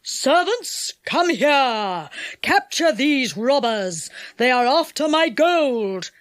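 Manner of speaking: slow, commanding villain's call; enchanter's shout. A narrator's reading. Enchanter summons servants against robbers, shouting commands. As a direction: order the servants to seize them. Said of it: "shouted the enchanter in a loud voice".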